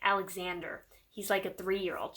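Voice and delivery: normal talking voice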